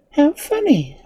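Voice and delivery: put-on high girlish exclamation; exclaiming. A narrator's reading. Girl seeing the dancing cutlery, exclaiming in amusement. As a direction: exclaim it in a little girl's high voice. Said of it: "exclaimed Dorothy".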